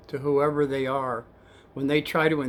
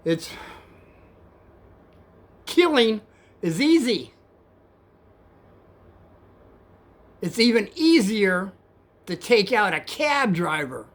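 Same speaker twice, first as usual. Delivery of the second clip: raised, halting threat with long pauses; retold flipping out; 5 semitones higher, 5 dB louder than usual. Passenger threatening the cab driver, flipping out. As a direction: imitate a menacing rider, pitched up and emphatic. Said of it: "he flips out again, totally at me".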